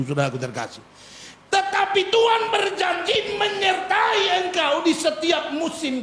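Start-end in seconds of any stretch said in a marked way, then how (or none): 1.5-6.0 high, slow preacher's shout; full-force preaching. Acted. (khotbah berseru lantang)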